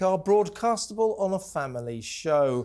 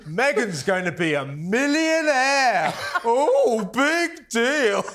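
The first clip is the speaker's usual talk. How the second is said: drawn-out mock awe, climbing higher and louder; mocking mimicry; 4 semitones higher, 3 dB louder, much slower than usual. Host mocking a viewer's boast about Megan, exaggerated scornful mimicry. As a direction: parody the boast slowly, then scoff with laughter. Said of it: "She goes".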